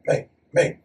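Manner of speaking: imitating a puzzled, hushed question to a mate; hushed aside. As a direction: softly ask a mate on the sly, retold. Quietly asking teammate during coach's talk.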